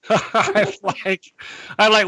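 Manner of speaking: ordinary talk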